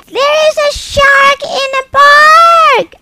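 high, loud panicked shriek; little boy screaming about a shark; terrified scream. Acted. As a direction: scream it out in fright. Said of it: "he screamed".

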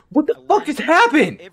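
high, loud outburst; stunned furious shout; shocked yell. Real, not acted. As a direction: blurt it out in disbelief. Sudden fall in the game.

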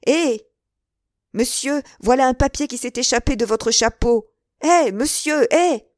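loud, high, rising hails; calling after. A narrator's reading. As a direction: run and shout to catch him. Stable boy calling after rider.

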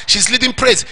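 usual speaking voice

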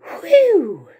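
high, loud whew of relief; relieved whew. Acted. Dog relieved after the dragon flees, high whew. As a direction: let out a big relieved whew. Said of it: "said the dog".

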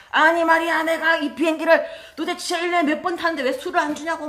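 put-on loud, high furious outburst from a drunk passenger; angry drunk shout. Drunk passenger yelling at the flight attendant, furious shout. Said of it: "갑자기 막 화를 내면서".

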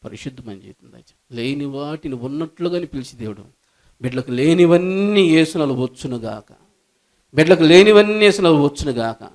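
low, soft praying voice over music; closing prayer. Acted. Pastor praying over the congregation, low and soft. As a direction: pray softly, swelling briefly, then hushed.

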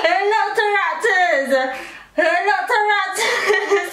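high, laughing through a guard; mouth propped open. Real, not acted. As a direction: mangle every word round a guard. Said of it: "I cannot hear a word".

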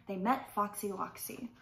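ordinary delivery, nothing marked

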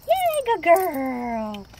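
high, loud, slow gushing praise; dog praise. Real, not acted. Owner cheering her dog, high and slow.

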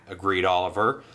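usual speaking voice

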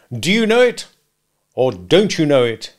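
loud, pointed either-or demand; browbeating lawyer's question; bullying interrogation. Acted. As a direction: corner the witness. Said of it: "in a bullying, interrogative manner".

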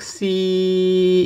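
"C" held long and very slow on one level pitch; flat tone. Shown as a demonstration. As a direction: stretch "C" out with no pitch change. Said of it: "meaning that it's always constant".

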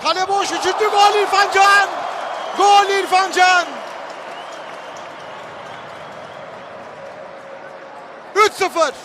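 screaming football commentator. Real, not acted. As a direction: yell the goal at full voice. Goal call in live commentary.

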